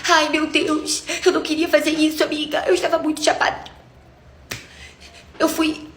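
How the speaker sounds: high, whiny imitation of the friend crying. Cheating friend confessing on the phone, a high mock-tearful whine. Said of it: "E aí ela começou a chorar".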